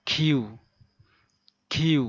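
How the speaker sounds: খিউ said loud, with the tongue drawn back; খিউ with a breathy kh. Shown as a demonstration. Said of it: "জিভটা একটু ভিতরের দিকে". (জিভ ভিতরে নিয়ে জোরে খিউ)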